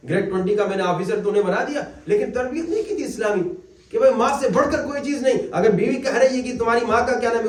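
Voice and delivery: ordinary delivery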